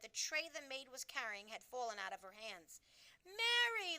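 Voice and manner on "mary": higher, louder sharp call of the name. A narrator's reading. Queen calling her maid sharply after the crash. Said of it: "the famous voice was saying rather sharply".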